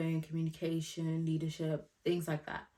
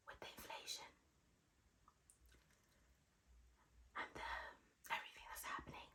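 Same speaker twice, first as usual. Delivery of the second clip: hushed confidential whisper; 21 dB quieter than usual. Real, not acted. Vlogger confiding the catch about pay to viewers, whispering. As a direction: whisper it low, just between the two of you. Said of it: "I don't know why I'm whispering".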